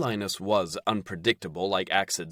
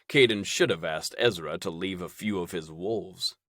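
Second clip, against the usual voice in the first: plain reading voice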